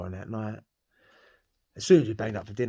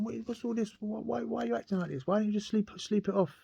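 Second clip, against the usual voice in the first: alarmed guard; 12 semitones higher than usual. Retold or imitated. Guard at the door seeing the blade, high mock alarm. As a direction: play the guard thrown by the razor.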